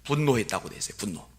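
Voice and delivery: ordinary talk, nothing marked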